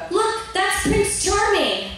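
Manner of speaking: put-on high, slow little-girl voice. Little girl showing the storybook, in a high, slow voice. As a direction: say it like a wide-eyed little girl. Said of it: "and she goes".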